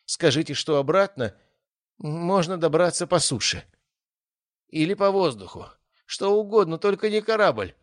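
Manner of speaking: high, desperate pleading; beg, rising in despair. A narrator's reading. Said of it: "умоляюще обратился он".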